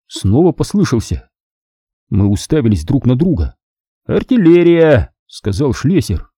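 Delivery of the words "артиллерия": slow, louder, higher exclamation; alarmed cry. A narrator's reading. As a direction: call out the alarm. Soldiers hearing distant guns.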